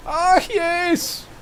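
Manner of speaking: high, hammed-up German accent; playful fake German accent. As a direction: burst into a mock German voice. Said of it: "I don't know where that German accent just came from".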